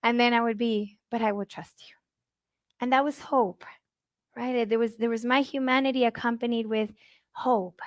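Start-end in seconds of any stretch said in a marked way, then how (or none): 1.1-1.9 soft whisper of surrender; whispered trust. Retold or imitated. Mother turning back to faith, whispered. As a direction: breathe the promise almost silently. Quiet surrender after anguish.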